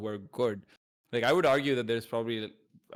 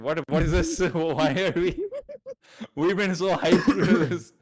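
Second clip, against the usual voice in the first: higher and louder, laughing through the words, slowing at the end; laughing disbelief; 6 semitones higher, 8 dB louder than usual. Retold or imitated. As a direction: retell the shock as a half-laughing outburst. Retold shocked reaction to forfeit.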